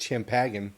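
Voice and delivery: "champagne" with a hard ch and hard g, cham-pag-in; spelling pronunciation. Shown as a demonstration. Arguing spelling isn't sound, "champagne" as cham-pag-in. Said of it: "champagne spelled C H".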